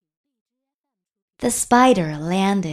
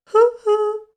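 high, loud hooting; two airy, high hoots; owl hoot; 13 semitones higher, 6 dB louder than usual. A narrator's reading. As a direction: imitate an owl. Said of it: "asked the owl".

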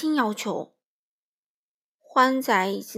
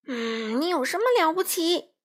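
indignant, higher-pitched challenge; angry squawking; 7 semitones higher than usual. A narrator's reading. Little crow challenging the wolf at the castle, angry squawk. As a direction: challenge the intruder crossly. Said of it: "花袜子气得呱呱叫".

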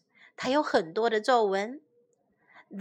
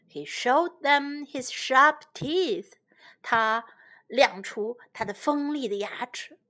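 ordinary delivery, nothing marked

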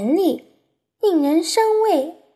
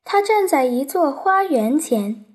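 plain reading voice

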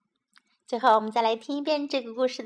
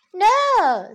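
little bunny; 8 semitones higher, 8 dB louder than usual. A narrator's reading. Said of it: "said little Bunny".

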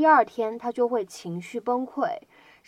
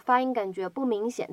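normal talking voice

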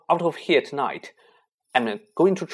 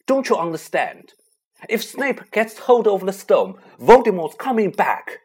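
higher and louder, urgent and fierce; impassioned outburst; 7 semitones higher, 9 dB louder than usual. A narrator's reading. Harry insisting to his friends he must go: fierce outburst. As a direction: pour out desperate anger.

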